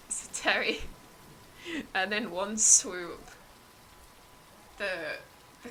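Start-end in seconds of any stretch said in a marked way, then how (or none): none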